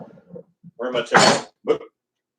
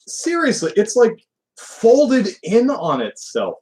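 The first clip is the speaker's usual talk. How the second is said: exasperated disbelief; 10 semitones higher than usual. Real, not acted. Exasperated repeat of complaint.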